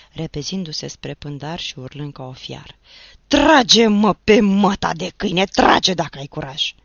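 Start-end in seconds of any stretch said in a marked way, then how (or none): 3.3-6.7 much louder defiant howl; furious roar. Acted. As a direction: dare him to shoot, enraged. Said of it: "la auzul urletului". (urlet furios și sfidător)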